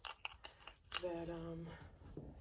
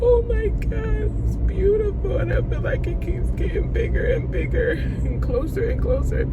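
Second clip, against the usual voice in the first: high, tearful voice breaking into whisper; awed crying; 12 semitones higher than usual. Real, not acted. Driver watches the rainbow getting closer, crying in awe. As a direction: weep in amazement, drawing out the exclamations. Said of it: "that's making me cry".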